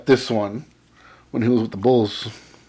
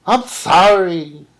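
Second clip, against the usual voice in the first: put-on quoting the old man, apologetic; 7 semitones higher, 6 dB louder, much slower than usual. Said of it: "he says".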